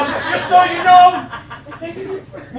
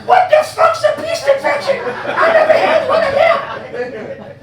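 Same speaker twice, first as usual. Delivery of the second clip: put-on much higher, whiny kid voice; squeaky child voice; 13 semitones higher than usual. Comedian mocks heckler as a child asking mommy, squeaky. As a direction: play a confused little kid asking mommy. Audience member's kid voice mocked.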